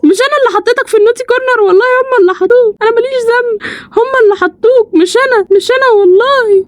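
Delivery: put-on high, pleading voice to the baby; high whiny plea; pleading baby talk. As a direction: beg the baby it wasn't your fault.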